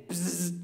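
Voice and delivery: short "bzzz" buzzing noise; imitated insect buzz. Storyteller hearing it again while calling the neighbor, imitated buzz.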